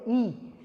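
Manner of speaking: "ई" as a short i; "ई" said short, not "aai"; short i sound. Shown as a demonstration. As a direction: model "ई" short and lax. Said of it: "आप आई नहीं बोलेंगे".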